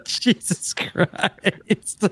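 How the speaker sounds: breathless wheezing laugh. Real, not acted. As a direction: laugh it out, barely voiced. Laughing at exit reveal.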